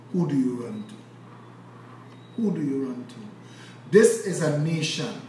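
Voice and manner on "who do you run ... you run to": low, soft and slow, who do you run to; hushed rhetorical question. Acted. Asking the audience about abused children, hushed and low. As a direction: let it sink in quietly.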